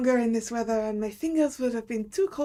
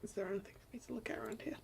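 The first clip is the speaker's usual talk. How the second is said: whispering; 15 dB quieter, much faster than usual. Real, not acted. Quiet exploring whisper.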